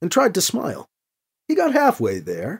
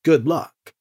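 plain reading voice

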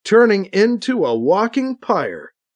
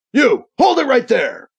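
higher-pitched challenge, voiced as a shout; guard's shout; 7 semitones higher, 3 dB louder than usual. A narrator's reading. Guardsman spots Alibi and orders him to stop, shouting. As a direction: call out a sharp halt order. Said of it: "he shouts at Alibi".

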